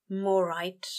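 usual speaking voice

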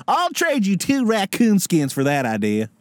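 hammed-up Southern trader; Southern accent. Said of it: "The Apprentice, but for, uh, Southern people".

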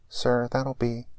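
crackly drive-thru speaker voice; like it's coming through a tinny box; mechanical speaker voice. Acted. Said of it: "A mechanical voice crackles".

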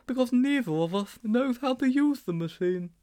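put-on high boyish character voice; high-pitched character protest; Sponge protesting. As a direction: object in a high schoolboy voice.

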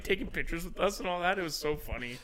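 ordinary delivery, nothing marked

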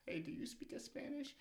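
high-pitched mock questioner; imitated asker. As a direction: put on a high voice to ask. Acts out someone asking in class.

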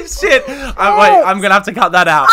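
laughing at her own story, she blurts through the giggles. Real, not acted. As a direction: talk while still cracking up.

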